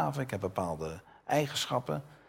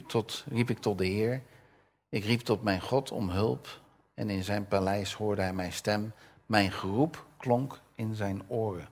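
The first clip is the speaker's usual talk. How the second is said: normal talking voice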